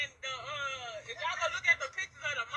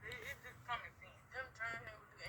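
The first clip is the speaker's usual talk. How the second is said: fading to near whisper; 5 semitones lower, 7 dB quieter than usual. Real, not acted. Voice fades during emotion.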